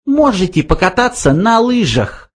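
high little voice, taunting; caustic mouse. A narrator's reading. Mouse suggests skiing on glaciers, caustic high voice. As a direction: needle him in a high mouse voice. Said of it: "язвительно предложил Бенджи".

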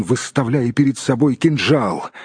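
ordinary delivery

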